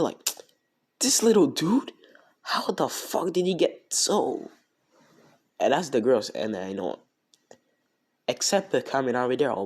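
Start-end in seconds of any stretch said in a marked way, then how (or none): 1.0-4.5 acted-out shock, pitched way up; high, drawn-out mock astonishment; shocked disbelief. Shocked onlookers react to the transformed classmate, high-pitched acted disbelief. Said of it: "they're shocked".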